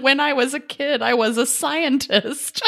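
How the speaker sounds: slow, high, lightly mocking; mock proud child. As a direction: repeat it slowly, teasing. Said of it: "different thing than".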